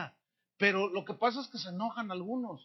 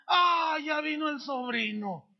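loud, high cry of dismay from the aunt; aunt whining as the nephew walks in; mock dismayed aunt; 7 semitones higher, 5 dB louder, slower than usual. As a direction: play the aunt groaning that the nephew arrived. Aunt voiced at the party.

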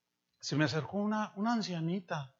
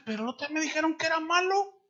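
put-on naive young man; 9 semitones higher, faster than usual. Worship leader quoted.

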